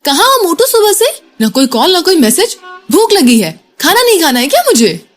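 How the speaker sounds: put-on heated angry girlfriend. A narrator's reading. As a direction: scold him hungrily for not calling. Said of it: "चश्मिश की तमतमाई आवाज आई". (ऊँची, तमतमाई डाँटती आवाज़)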